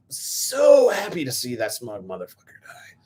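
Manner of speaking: slow whisper with relish; gleeful whisper. Real, not acted. Fan gloats over a hated character's death in a whisper. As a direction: savor it in a hushed whisper.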